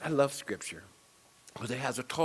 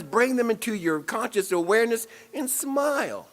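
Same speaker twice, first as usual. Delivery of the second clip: delighted; 8 semitones higher, 6 dB louder than usual. Real, not acted. Joyful riff on smiling.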